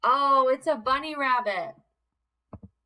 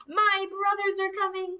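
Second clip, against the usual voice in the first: high, squeaky goat cry; little goat cry; 8 semitones higher than usual. Acted. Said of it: "cried the little goat".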